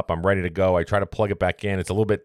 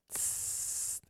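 hiss noise; 20 dB quieter than usual. Retold or imitated. Imitating the toilet's hiss.

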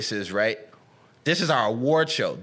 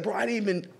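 higher-pitched imitation of a baffled man; protesting innocence; 8 semitones higher than usual. Voicing the rapper at the awards, protesting innocence. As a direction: mimic a bewildered denial, pitch up. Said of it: "And he's just like".

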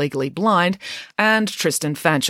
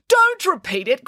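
cried out; 12 semitones higher than usual. A narrator's reading. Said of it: "cried the headmaster".

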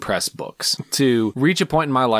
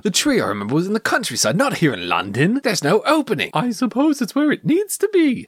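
high, lilting British film-hero impression; Ewan; 10 semitones higher than usual. Quoting the film's puzzled man about the tree, high British impression. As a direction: put on a swooping, puzzled British voice. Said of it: "I feel like my Ewan was pretty good".

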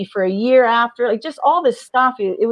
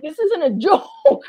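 laughing through the words, higher; laughing insistence; 6 semitones higher than usual. Retold or imitated. Retelling pleading with Rick, laughing through it. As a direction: insist it's no joke with a laugh.

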